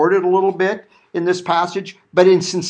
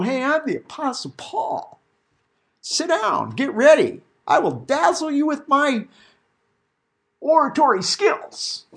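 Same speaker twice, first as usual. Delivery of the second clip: higher, showy mock orator, slowing on the last words; pompous Paul; 7 semitones higher than usual. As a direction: ham up a self-important preacher showing off. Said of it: "I don't put on airs as though".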